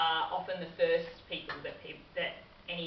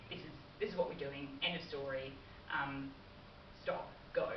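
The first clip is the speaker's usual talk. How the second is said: "This is what we're doing, end of story" falling low and soft at each end; downward inflection; 4 semitones lower, 7 dB quieter than usual. Shown as a demonstration. Trainer demonstrating a dominant falling tune to staff. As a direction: drop the pitch at the end of every phrase, settled and final. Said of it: "downward inflection can be quite powerful and dominant".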